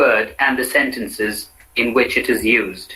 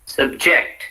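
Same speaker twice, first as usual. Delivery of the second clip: "subject" as sub-JECT; weak first vowel in subject, stress on JECT; stress on second syllable. Shown as a demonstration. Teacher models the verb for repetition, subject stressed on JECT. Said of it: "said in two different ways".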